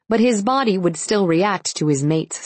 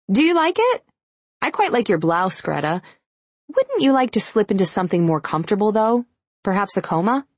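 sarcastic; 5 semitones higher than usual. A narrator's reading. Taryn snipes back at Greta.

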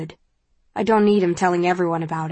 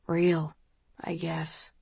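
put-on soft, slightly drunken confession trailing down; softened voice; 8 dB quieter, much slower than usual. A narrator's reading. Admitting something to the person he invited, softened voice. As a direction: let the voice drop to a soft admission. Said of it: "His voice softens".

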